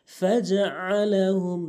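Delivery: slow chanted ফাজআলাহুম with throaty ain; correct ain. Shown as a demonstration. Teacher modelling the verse, throaty ফাজআলাহুম. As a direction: squeeze and stretch the ain in ফাজআলাহুম. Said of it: "আইনের উচ্চারণ করতে হবে".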